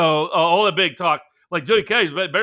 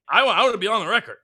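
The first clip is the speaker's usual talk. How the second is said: usual speaking voice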